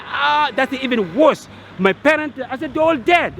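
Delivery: exasperated retort. Retold or imitated. Telling the embassy lady about parents, exasperated. Retold exasperated reply to official.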